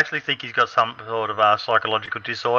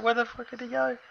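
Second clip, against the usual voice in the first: surprised; 13 semitones higher, 10 dB quieter than usual. Retold or imitated. Retold panic while chasing teammate.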